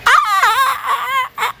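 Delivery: high, broken "ah-ah-ah" squeal; stuttering shriek. Real, not acted. Gamer reacting to a step that levels them up, high stuttering squeal. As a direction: let out a pulsing high-pitched cry.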